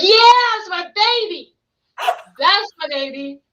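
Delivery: high, cooing proud mom voice; doting mom. Retold or imitated. Mom cooing proudly over her grown child. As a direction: coo over her baby like a proud mother. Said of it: "Your mom's like".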